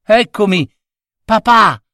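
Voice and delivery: put-on high, bright-pitched boy's call; eager exclamation. A narrator's reading. Boy arriving home to father, eager exclamation. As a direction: announce yourself to father. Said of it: "esclamò Tonino".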